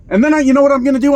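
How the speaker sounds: imitating an eager driver's rush; mock excited burst. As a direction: rattle it off, fired up to try again. Retold eager driver's bold plan.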